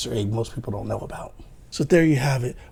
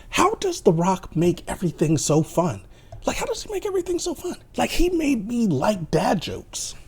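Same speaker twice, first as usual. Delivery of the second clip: high, squeaky amazement; gushing wonder; 9 semitones higher than usual. Real, not acted. Reviewer gushing over how fun The Rock is, amazed. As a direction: marvel at it, pitch climbing sharply.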